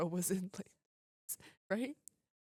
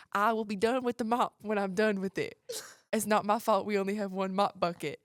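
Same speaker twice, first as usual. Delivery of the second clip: put-on louder and louder country-accent snap; 9 dB louder than usual. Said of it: "responded in the country accent".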